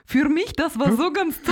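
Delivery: put-on Bruce Darnell with a Polish-sounding accent; slow, high, failed American accent that comes out Polish. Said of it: "Das ist dein polnischer Akzent".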